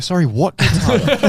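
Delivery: high, loud and confused "what gets harder?"; baffled question. Retold or imitated. Teller repeating his confused reply to an old man, high and loud.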